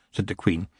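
usual speaking voice

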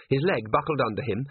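ordinary delivery, nothing marked

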